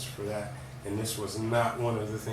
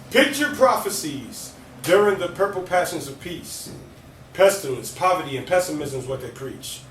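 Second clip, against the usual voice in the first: rhythmic alliterative poem, louder; spoken word poetry; 5 semitones higher, 7 dB louder than usual. Acted. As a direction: perform it with punch. Recites poem Perpetual.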